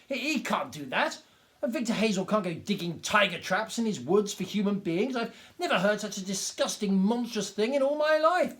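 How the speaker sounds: high, loud outraged rant; hopping mad, spitting indignation; in fury. A narrator's reading. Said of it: "jumping up and down in fury".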